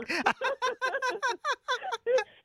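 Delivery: high-pitched bashful giggle. Real, not acted. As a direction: let out a shy, squealing giggle. Shy giggle after teasing.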